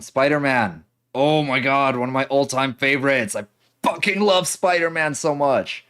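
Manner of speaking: high, loud fan gushing; excited. Real, not acted. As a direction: squeal about a favorite hero. Gushing over favorite character.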